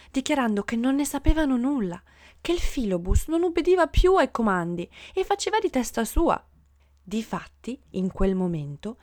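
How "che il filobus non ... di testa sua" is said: usual speaking voice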